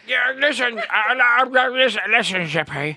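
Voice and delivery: high, loud cartoon puppet voice; puppet impression. Impressionist as George telling Zippy to listen, high puppet voice. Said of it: "No, you are Zippy".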